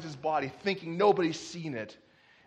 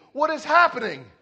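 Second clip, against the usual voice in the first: mock alarm; 8 semitones higher, 9 dB louder than usual. Cocky Locky acted, alarmed by panic.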